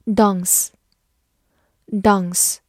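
"dance" with a long, open "ah" vowel; British-style "dance", rhyming with "stance" said as "stahns"; British pronunciation. Shown as a demonstration. British model, contrasted with American reading.

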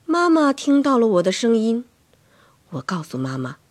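ordinary delivery, nothing marked

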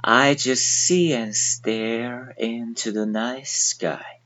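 slow, soft read-along; 慢速带读. Shown as a demonstration. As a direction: read it slowly with the class. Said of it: "现在跟我一起来读".

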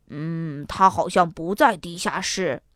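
low-pitched dad, pondering; low voice of a father in a story; father's voice. A narrator's reading. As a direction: answer as a dad, pitched well down.

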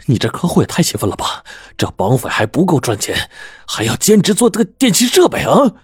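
indignant demand, leaping to high pitch at both ends; angry confrontation. A narrator's reading. Employee grabs his boss's collar and demands answers, angry. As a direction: accuse him, climbing to an outraged peak.

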